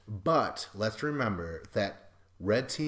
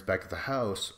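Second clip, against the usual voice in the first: ordinary talk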